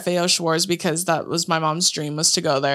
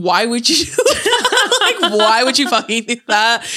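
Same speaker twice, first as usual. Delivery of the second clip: incredulous question through laughter; helpless giggle breaking up the words; dying laughing; 4 semitones higher, 3 dB louder than usual. Retold or imitated. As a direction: crack up while retelling your disbelief. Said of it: "I was dying".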